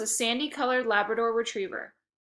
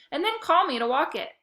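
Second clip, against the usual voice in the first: higher, hopeful suggestion; eager suggestion; 7 semitones higher, 4 dB louder than usual. A narrator's reading. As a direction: push the idea brightly. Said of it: "I suggested".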